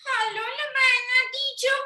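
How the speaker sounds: very high-pitched kitty voice; cat character. Pretend. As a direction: greet the teacher as a little pretend cat. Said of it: "and cat comes".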